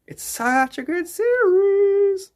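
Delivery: mock high singsong; high slow put-on voice. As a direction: stretch it out in a silly high voice. Repeats line in put-on voice.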